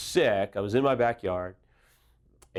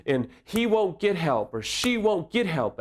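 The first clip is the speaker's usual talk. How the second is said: higher, fast exasperated griping; mock frustrated complaint; 6 semitones higher than usual. Betrayed spouse venting about partner, mock exasperated. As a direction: imitate a fed-up partner venting that nothing moves. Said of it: "the betrayed will say".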